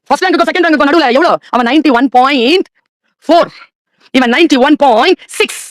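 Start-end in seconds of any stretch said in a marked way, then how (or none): 1.5-5.6 each number laid out slowly; slow spelled out. Shown as a demonstration. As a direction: drag the numbers out to weigh them. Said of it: "spelling out marks slowly". (மெதுவாக எண்களை அழுத்திச் சொல்லுதல்)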